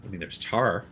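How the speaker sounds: ordinary delivery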